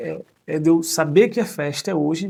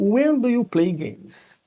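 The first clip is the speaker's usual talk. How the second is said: slow model question; 7 semitones higher, 3 dB louder, much slower than usual. Shown as a demonstration. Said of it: "por exemplo".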